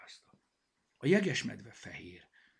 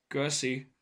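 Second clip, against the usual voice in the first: loud, sarcastic thanks, pitched up; snarled thanks; 4 semitones higher, 6 dB louder than usual. A narrator's reading. Zsutek scorns an obvious answer, snarling. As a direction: growl at them, unimpressed. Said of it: "mordult rá Zsutek".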